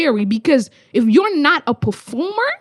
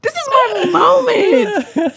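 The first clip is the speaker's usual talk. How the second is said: hyped shout; 4 semitones higher, slower than usual. Retold or imitated. Hyping herself against skeptics, shouting. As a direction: shout it like a challenge. Acted defiant bravado.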